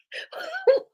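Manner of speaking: squeaky burst of a laugh; high squeal of laughter. Real, not acted. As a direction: let a high laugh escape. Laughing at the video clip.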